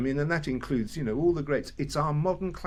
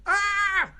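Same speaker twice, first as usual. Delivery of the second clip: sudden very high loud scream, cut short; falling scream; 5 dB louder than usual. Retold or imitated. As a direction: yell as if falling off a building. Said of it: "Like that".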